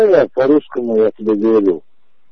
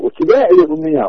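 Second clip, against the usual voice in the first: heated retort; faster than usual. Real, not acted. Heated phone argument about accent.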